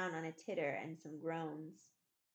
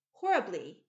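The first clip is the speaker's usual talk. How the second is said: loud, falling, stunned; amazed reply; 9 dB louder than usual. A narrator's reading. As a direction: answer in disbelief. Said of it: "gazing at her in amazement".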